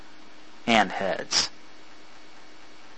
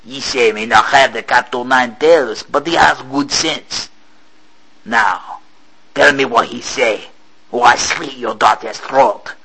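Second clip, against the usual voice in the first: threat. Acted. Captain threatening Poirot.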